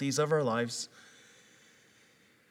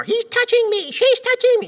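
put-on high, loud whining child; whiny kids; 11 dB louder than usual. Dad imitating his kids squabbling in the car, high whine. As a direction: squeal it like a bickering kid.

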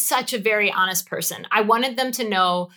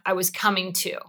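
ordinary delivery

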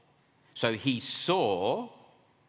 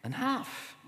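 fed up; 12 semitones higher, 5 dB quieter than usual. Acted. Fed-up believer sighs.

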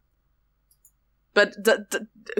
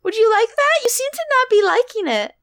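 put-on high cutesy e-girl voice; teasing Discord kitten voice; 10 semitones higher than usual. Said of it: "What if I spend the rest of the episode doing this".

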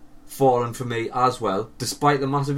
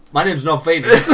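laughing mockery; 4 semitones higher, 8 dB louder than usual. Mocking her lie, laughing.

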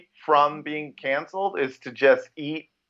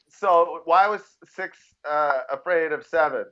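ordinary delivery

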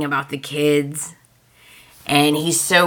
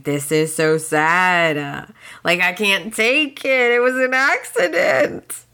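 mock wailing; 2 semitones higher than usual. Fan reacting to a sad soap scene, mock wailing. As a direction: wail it in exaggerated heartbreak, pitch rising to the end. Viewer's hammed-up upset reaction.

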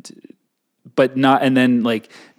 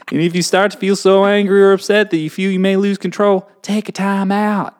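put-on Southern accent; 6 semitones higher than usual. Reading the time-out rule to co-host, higher Southern accent. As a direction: pitch it up in a Southern twang. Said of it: "do it in a Southern accent".

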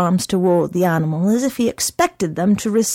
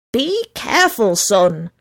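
shouted. Acted. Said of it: "shouted the mother".

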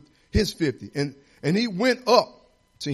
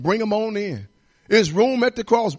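ordinary talk, nothing marked

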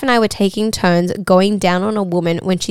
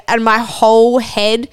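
ordinary talk, nothing marked

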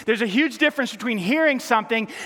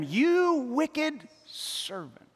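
very slow, softer, grave rebuke; stern master; 5 semitones higher, 4 dB quieter, much slower than usual. Acted. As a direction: pronounce judgment slowly. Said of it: "his master summoned him and said".

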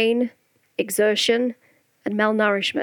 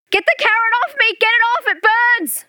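much higher, louder, fast panicked shrieking; hysterical scream; 12 semitones higher, 4 dB louder than usual. A narrator's reading. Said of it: "screams Gordy hysterically".

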